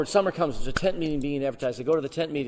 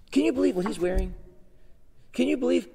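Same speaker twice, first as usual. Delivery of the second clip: high mock gossip, raised; catty gossip voice; 9 semitones higher than usual. Church gossip mocking the preacher, catty.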